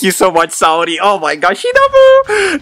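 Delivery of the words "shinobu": very high, loud squeal of a name; excited squeal. Real, not acted. Fan thanking Saori for the figure, excited squeal.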